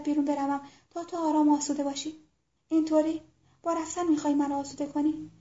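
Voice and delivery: hushed, intimate confession voice; soft tender dialogue. Acted. Soft-spoken lovers' dialogue.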